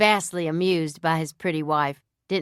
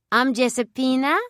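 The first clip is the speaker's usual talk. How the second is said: put-on higher Italian-accented voice introducing herself; 8 semitones higher, 4 dB louder than usual. A narrator's reading. Dancer introduces herself to the guests, Italian accent. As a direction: say your name like an Italian dancer. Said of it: "with an accent no different from what an Italian might have".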